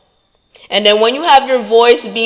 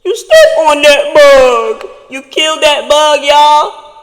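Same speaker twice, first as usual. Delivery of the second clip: emphatic stomp; 5 semitones higher, 5 dB louder than usual. Acted. Stomping on the doubt bug.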